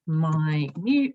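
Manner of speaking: ordinary talk, nothing marked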